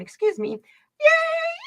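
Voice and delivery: a shrill, sudden yay shot way up high; delighted squeal on the phone while walking; loud scream. Retold or imitated. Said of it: "I scream really loud".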